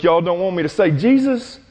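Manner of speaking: defiant mockery in a high voice, each Jesus thrown back at them; mocking. Peter taunts the council with the name, high and mocking. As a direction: taunt them by saying the forbidden name over and over. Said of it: "He's, he's mocking them".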